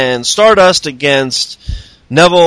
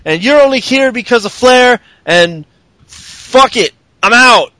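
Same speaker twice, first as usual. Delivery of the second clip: mock Paige rant; 6 semitones higher than usual. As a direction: ham up a furious Paige. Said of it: "and Paige is all".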